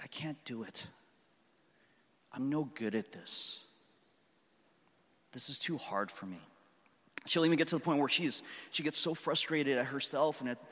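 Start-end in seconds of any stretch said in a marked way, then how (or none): 0.0-6.4 put-on defeated little girl. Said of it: "she'll look up and she's like".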